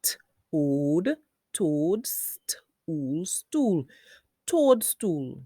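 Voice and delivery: "Toadstool" built up part by part; slow phonics blend of "Toadstool". Shown as a demonstration. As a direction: sound out "toad", then "stool", then join "Toadstool". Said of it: "Put them together".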